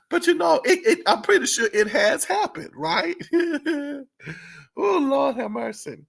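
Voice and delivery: high, giggly talk breaking into laughs; struggling to get past real giggles; laughing. Real, not acted. Vlogger insisting the studio walkout has happened, laughing. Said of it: "I don't know why I'm laughing".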